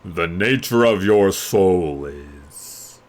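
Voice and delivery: an ancient mirror delivering a verdict; slow solemn magic mirror; oracle mirror voice. Acted. Magic mirror pronounces judgment.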